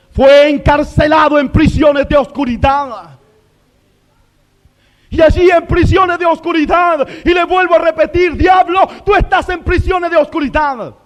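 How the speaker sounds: high, forceful shouted declaration; full-force preaching. Real, not acted. Preacher telling the devil he is imprisoned, full-force preaching. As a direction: shout it as a verdict on the enemy.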